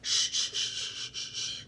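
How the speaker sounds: repeated hissed shush, fading; teacher's shushing. Retold or imitated. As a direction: imitate a teacher hushing a class. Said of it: "the teacher's like".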